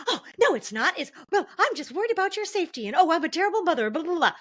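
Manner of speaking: high, fast, flustered mock defensive mother; hammed-up mother protesting too much; defensive mom. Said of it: "you're gonna be super defensive".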